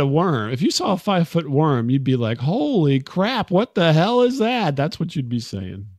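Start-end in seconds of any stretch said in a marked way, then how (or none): 2.4-4.8 mock shock. Imagined person spotting a five-foot worm, mock shock. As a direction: act out stunned alarm, stretching the words.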